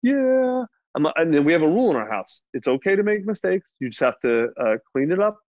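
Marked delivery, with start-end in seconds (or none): 0.0-0.6 very high, drawn-out toddler imitation; mock-weepy kid voice; sad little child. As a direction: answer like a tearful three-year-old. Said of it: "Are you sad 'cause daddy yelled?".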